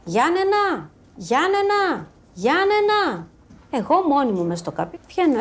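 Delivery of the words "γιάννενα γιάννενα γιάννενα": high mock call across the room, repeated. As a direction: call the name out like an old man at the door. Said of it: "αρχίζει να φωνάζει".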